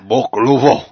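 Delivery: cartoonish Italian one-word punchline; bad Italian accent. Podcaster repeating his punchline, cartoonish Italian accent. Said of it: "like, Cartman Italian".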